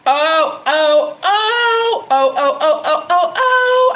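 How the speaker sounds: high, loud, excited run of "oh"s; big-message exclamation. Real, not acted. Reader reacting to a big message for Jeremy, excited high cries. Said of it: "I gotta say it like that".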